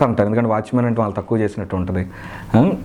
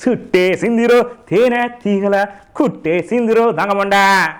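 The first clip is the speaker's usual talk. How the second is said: high, slow character-actor voice; stretched, raised comic impression; 10 semitones higher, slower than usual. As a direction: drawl the lyrics and end on mock abuse. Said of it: "మన JP గారు".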